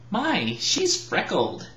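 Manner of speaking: slow, changed voice of another fairy; another fairy's voice. Acted. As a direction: drawl it out as a different fairy. A fairy's remark quote.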